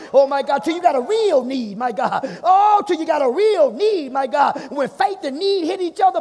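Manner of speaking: loud, high, driving sermon delivery; full-voiced preacher in full swing; fervent preaching. Real, not acted. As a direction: preach it with rising force.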